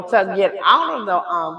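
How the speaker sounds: usual speaking voice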